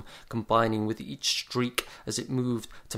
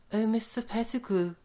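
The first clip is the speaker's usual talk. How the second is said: high, breathless lovestruck woman; breathy swoon; 13 semitones higher than usual. A narrator's reading. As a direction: sigh it out, swooning. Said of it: "breathed Miss Bronwyn".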